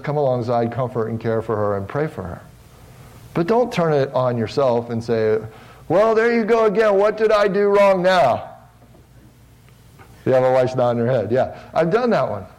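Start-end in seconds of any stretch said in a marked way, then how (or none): 5.9-8.5 higher, louder, put-upon husband's complaint; exasperated husband. Retold or imitated. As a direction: play the husband who feels blamed, exasperated. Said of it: "That's a direct quote".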